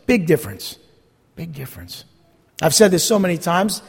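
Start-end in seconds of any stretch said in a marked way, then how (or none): 1.4-2.0 much softer echo, almost under the breath; soft repeat. Shown as a demonstration. Preacher letting his point settle on the congregation, hushed. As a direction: let the repeat sink in quietly.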